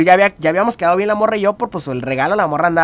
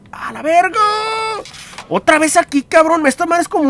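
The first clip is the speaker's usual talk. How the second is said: surprised outburst; 10 semitones higher than usual. Real, not acted. Sudden exclamation in the story.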